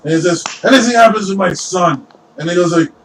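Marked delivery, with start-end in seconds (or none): 0.6-2.1 higher-pitched protective warning; warning threat. Acted. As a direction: warn them off your son. Lord threatens the party.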